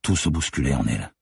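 ordinary delivery, nothing marked

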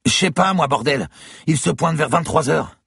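very high, agitated voice; exasperated shouting. A narrator's reading. Woman pressed by the gunman for a time, exasperated shouting. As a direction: burst out under pressure.

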